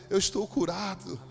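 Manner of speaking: slow, emphatic joyful declaration; jubilant healed man. Retold or imitated. Healed man jumps up to testify, jubilant. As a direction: retell a healed man's rising cry of triumph. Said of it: "começou a pular e dizer".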